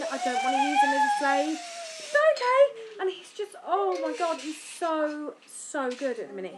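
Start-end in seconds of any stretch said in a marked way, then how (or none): 0.0-3.0 gentle mum. Retold or imitated. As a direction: reassure the child in a high sing-song. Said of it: "I'd be like".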